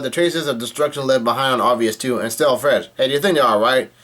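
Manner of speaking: usual speaking voice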